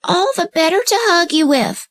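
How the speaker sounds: high put-on old lady voice; the wolf sweetly pretending to be grandmother; wolf in disguise imitating grandmother; wolf faking granny. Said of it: "the wolf replied".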